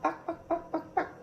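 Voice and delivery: high chirpy barks; light, high dog yaps for the kids; playful barking. Retold or imitated. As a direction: yap it like a tiny dog. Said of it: "what about bark".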